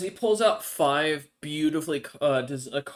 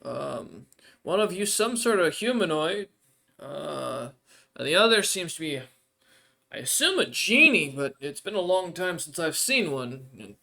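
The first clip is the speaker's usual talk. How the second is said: NPC voice; 3 semitones higher, 4 dB louder than usual. Acted. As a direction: lift into the odd creature's voice, grateful but hungry.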